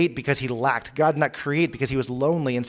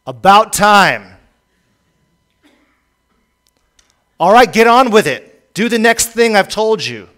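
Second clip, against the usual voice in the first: loud, high, curt commands from above; impatient, demanding voice; 4 semitones higher, 10 dB louder than usual. Retold or imitated. Preacher imagines God impatiently ordering Jesus: loud, impatient demand. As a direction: bark it like an annoyed taskmaster. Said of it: "the voice said".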